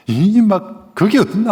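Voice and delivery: high, loud scolding of a son; sharp high rebuke; scolding parent. Retold or imitated. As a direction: scold like an exasperated father.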